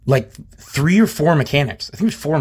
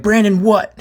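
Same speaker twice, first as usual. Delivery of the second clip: high, louder challenge; retold pressing demand; 9 semitones higher, 5 dB louder, slower than usual. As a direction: press him for an answer, pitched up and loud. Retold demand for a last name.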